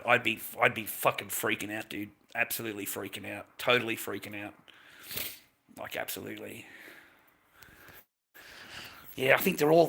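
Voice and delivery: normal talking voice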